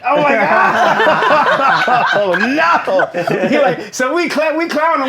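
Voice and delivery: high, loud, cracking up; can't stop laughing; laughing hard. Real, not acted. Cracking up recalling bad DJ set.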